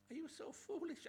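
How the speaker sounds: very high, stretched question; incredulous rebuke. Shown as a demonstration. Paul pressing the Galatians again, very high. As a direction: cry out foolish in disbelief.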